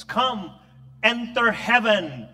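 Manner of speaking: slow, swelling welcome into heaven; solemn welcome. Pretend. Jesus welcoming the faithful into heaven, slow and solemn. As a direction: invite them in, slowly and grandly.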